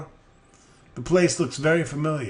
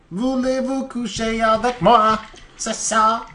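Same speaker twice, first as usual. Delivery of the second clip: high, hammed-up French crooning; sleazy Frenchman flirting in bad French; bad French accent; 9 semitones higher than usual. Pervert crook propositions detective in bad French. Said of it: "It's worse than my French".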